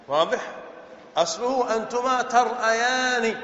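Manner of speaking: normal talking voice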